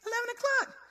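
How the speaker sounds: playfully imitating a puzzled boyfriend; boyfriend calling out. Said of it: "My boyfriend come out like".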